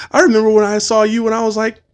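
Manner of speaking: high-pitched imitation of a kid; young kid. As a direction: pipe up like an excited child. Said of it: "some young kids coming up".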